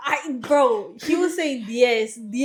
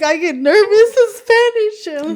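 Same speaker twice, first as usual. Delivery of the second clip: laughing excuse; 9 semitones higher, 10 dB louder than usual. Retold or imitated. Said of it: "I was like".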